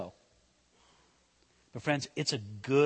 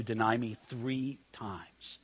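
softly whispered prophecy; whispered ending; 5 semitones lower, 8 dB quieter, slower than usual. Retold or imitated. Preacher voicing Jesus warning Peter, in a whisper. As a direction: drop to a whisper on the last words.

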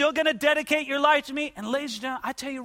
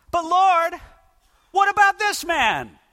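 higher and louder, protesting; Peter's protest; 7 semitones higher, 5 dB louder, slower than usual. Acted. Peter turning to Jesus about John, loud protest. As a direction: push back at Jesus, raised and indignant.

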